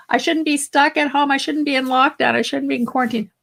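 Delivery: protesting. Acted. Voicing an inner complaint about being stuck at home, protesting. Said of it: "when we hear ourselves saying".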